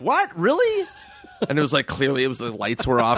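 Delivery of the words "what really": high fake innocent surprise; feigned shock; mock surprise. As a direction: play dumb, pitch way up. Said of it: "And we're like".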